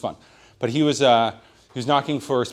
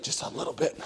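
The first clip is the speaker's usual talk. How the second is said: hushed "just a little bit"; whispered nudge; 10 dB quieter than usual. Retold or imitated. Nudging Jackson to talk up, in a whisper. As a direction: breathe it softly. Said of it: "But I'm just like".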